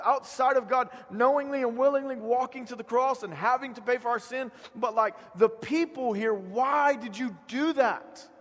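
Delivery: high, loud indignant demanding; self-righteous outrage. Retold or imitated. Preacher voicing his own self-righteous self, indignant shouting. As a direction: hammer them with self-righteous questions. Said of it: "look into my self-righteous self and go".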